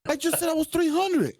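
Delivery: high-pitched protesting disbelief; indignant disbelief. Retold or imitated. Man protests to his girlfriend about the scale, high and baffled. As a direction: sound cheated and baffled. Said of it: "I said".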